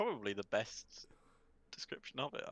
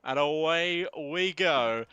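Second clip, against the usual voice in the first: lively play-by-play race call; race commentary; 7 semitones higher, 6 dB louder than usual. Acted. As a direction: call the race live, building excitement.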